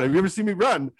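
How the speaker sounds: ordinary talk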